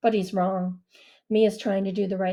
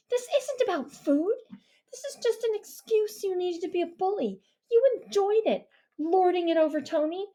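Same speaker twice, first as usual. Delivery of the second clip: put-on higher girl's voice, pressing hard; accusing girl's voice; 10 semitones higher than usual. A narrator's reading. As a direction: corner him, girl's voice. Mia voiced by narrator.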